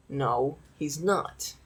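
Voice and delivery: slow, quiet guard reply; drawn-out dismissive guard; curt guard. Acted. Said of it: "his head tilts a little bit and goes".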